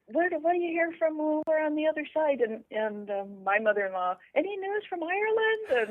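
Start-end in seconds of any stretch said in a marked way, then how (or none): 0.1-2.4 lightly voiced older relative, higher-pitched; imitated elderly questioner. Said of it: "year old people are always asking her". 4.4-5.9 high-pitched mock inquiry; imitated elderly questioner. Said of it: "year old people are always asking her".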